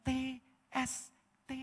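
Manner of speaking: a secret between lovers, whispered; hushed. Retold or imitated. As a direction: whisper the letters softly. Lovers' silent understanding.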